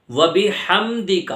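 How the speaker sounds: slow و بحمدک with breathed ح; heavy h in بحمدک. Shown as a demonstration. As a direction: stretch بحمدک and push the h. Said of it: "یہاں پر بھی بڑی ہا ہے".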